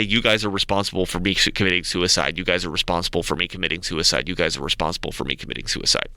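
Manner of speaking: a hint of a guilt-tripping official on the speaker; mock intercom announcement. Mocking the school announcer guilting protesting kids, low and drooping. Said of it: "come over the intercom and say".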